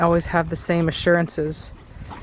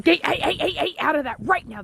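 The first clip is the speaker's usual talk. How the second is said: higher urgent hushed scold; whisper yelling; 7 semitones higher, 4 dB quieter than usual. Real, not acted. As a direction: scold the dog without raising volume. Scolding dog in garden.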